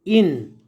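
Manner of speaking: इन read with a clear short i, as "in"; इन with the short i vowel; correct reading. Shown as a demonstration. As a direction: say इन as "in", the right way. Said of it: "क्या पढ़ेंगे".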